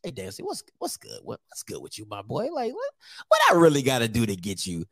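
low, hushed sweet-talking pitch; butter up the coach quietly; mock recruiter, low and soft; Southern recruiter. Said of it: "I'm like".

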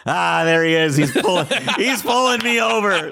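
high, laughing groan at being caught; laughing disbelief. Retold or imitated. Retelling the cop pulling him over, laughing. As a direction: laugh as the trap springs. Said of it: "two miles down the road".

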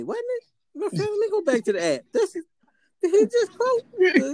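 high, laughing mock disbelief; mock amazed listener. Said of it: "or you like".

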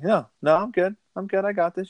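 high-pitched mock drunk insistence; high, tipsy brush-off; acted-out drunk. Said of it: "He's obviously hammered".

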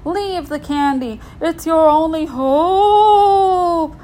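high, loud ghostly wail; spooky ghost.

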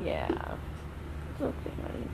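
slow soft whisper; whispered comfort. Real, not acted. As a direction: hush him gently, very slowly. Comforting the child.

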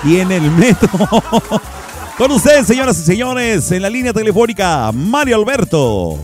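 anuncio de radio emocionado, más agudo; presentación de locutor. Acted. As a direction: presenta al invitado como locutor de show. Presentación del invitado en la radio. (voz de locutor anunciando al invitado)

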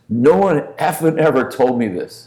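high-pitched, upset outburst; imitated weeping. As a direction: act out a tough guy's tearful protest. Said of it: "he's weeping, and he goes".

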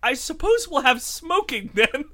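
a hint of stiff politeness, held back from laughing; mock polite acceptance. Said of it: "and just be like".

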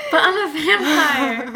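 hammed-up high, loud, slow cry; Edward, mock wail. Host echoing a vampire's cute thoughts, mock wail. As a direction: wail it in laughing mock anguish. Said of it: "Edward's thoughts are".

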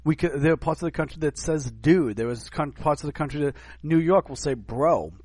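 ordinary talk, nothing marked

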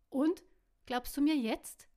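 put-on loud, high childish whine; whining like a child. A narrator's reading. Caro nagging Tack to believe her, childish whine. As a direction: nag him like a little kid. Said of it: "quengelte Caro wie ein kleines Kind".

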